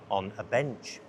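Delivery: ordinary delivery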